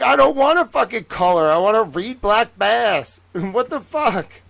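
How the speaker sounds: loud, high mock tantrum; hammed-up outburst of a frustrated kid; retold anger. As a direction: protest loudly, stretching the words. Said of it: "I used to get so mad".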